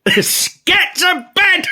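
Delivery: high, loud, slow stretched mock yell; imitating a fuming mum; angry order. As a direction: bark it like a furious mum, drawn out. Mum orders son to bed.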